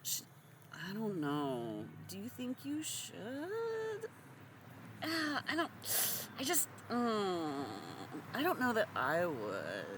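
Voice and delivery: high, coy, hesitant dithering; mock demure hand-wringing, pitched high. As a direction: play it meek and falsely unsure. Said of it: "in your most demure voice".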